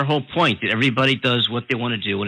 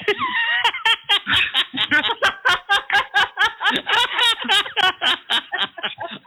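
long high burst of real laughter; a real, helpless laugh at himself. Real, not acted. Host laughs at own mistake.

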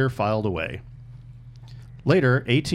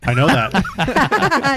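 laughing; 13 semitones higher, 7 dB louder, much slower than usual. Real, not acted. Laughs at face-for-radio joke.

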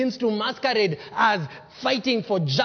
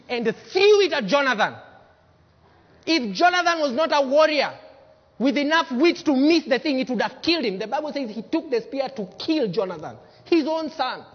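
heated retelling; 6 semitones higher, 5 dB louder than usual. Real, not acted. Excited telling of the spear.

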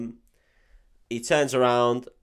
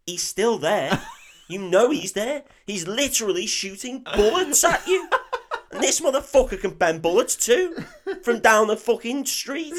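high-pitched, louder incredulous ranting; exasperated rant; 10 semitones higher, 4 dB louder than usual. Real, not acted. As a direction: argue in comic disbelief, voice pushed up. Incredulous rant at film's silly logic.

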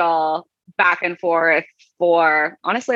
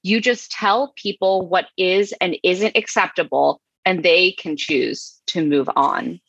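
usual speaking voice

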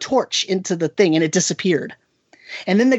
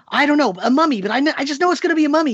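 higher and fast, insisting; pitched-up, hurried teen stubbornness; imitating the stubborn kid; 10 semitones higher, much faster than usual. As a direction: rush it out like a boy who won't be told. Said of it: "he says".

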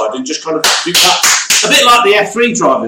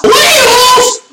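very high, loud, drawn-out cry; hammed-up radio drama scream; mock dramatic wail; 6 dB louder than usual. Actor in a crashing radio drama, high hammed-up wail. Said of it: "someone saying".

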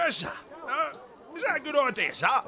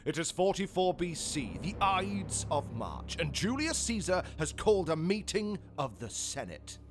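story narration; 6 semitones lower, 4 dB quieter than usual. A narrator's reading. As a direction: set the scene like a narrator. Narrator sets the scene.

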